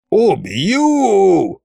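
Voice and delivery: high, long-drawn villain wail; theatrical howl at moderate volume; ghostly wail. A narrator's reading. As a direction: wail the threat, stretching the last vowel. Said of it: "завопил призрак".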